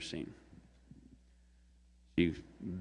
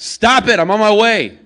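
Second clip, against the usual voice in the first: much higher and louder, yelling through the door; angry shout from inside; 12 semitones higher, 13 dB louder than usual. Acted. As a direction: bark it out, annoyed at being woken. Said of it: "the voice from inside".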